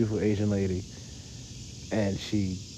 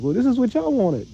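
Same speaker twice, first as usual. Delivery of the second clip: much higher, louder snippy quote; much higher, louder mock whiny retort; mock defensive girl; 12 semitones higher, 5 dB louder than usual. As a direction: sass it like a smug poster online. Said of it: "get online and be like".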